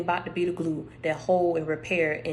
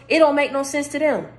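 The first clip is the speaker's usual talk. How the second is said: hoarse, pushed higher and louder; straining a tired, hoarse voice; losing voice; 9 semitones higher, 7 dB louder than usual. Real, not acted. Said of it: "You see I'm losing my voice?".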